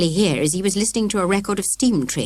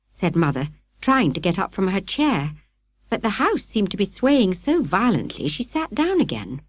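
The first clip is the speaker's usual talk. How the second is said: ordinary delivery, nothing marked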